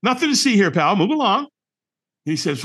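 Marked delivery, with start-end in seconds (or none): 0.0-1.5 high, breezy cover-up voice, brushing it off; airy false cheer to hide the panic; fake breezy I'm fine. To a stranger at the firewalk, faking fine in a high breezy voice. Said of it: "We go".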